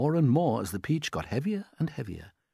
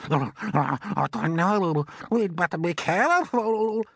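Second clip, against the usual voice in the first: a much higher, louder put-on aunt's voice, excited; Aunt Sponge; 7 semitones higher, 5 dB louder, slower than usual. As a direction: play the greedy aunt gaping at the peach.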